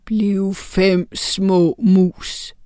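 very slow troll incantation; slow troll spell; troll casting spell. A narrator's reading. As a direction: draw out the spell word by word. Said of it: "sagde han".

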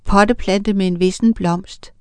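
usual speaking voice

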